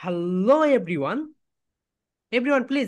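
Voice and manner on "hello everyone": "Hello" drawn out with a swell on the second syllable; slow "Hello", its "-lo" swooping up and down; stretched stress on Hello. Shown as a demonstration. Teacher models a presentation intro for students, stretched stress on "Hello". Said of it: "এখানে তোমরা stress দিবে".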